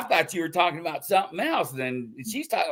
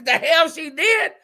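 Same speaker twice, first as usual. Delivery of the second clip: high, louder mock protest; retold indignant retort; 13 semitones higher, 4 dB louder than usual. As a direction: fire back in mock indignation. Acted retold argument with Mary.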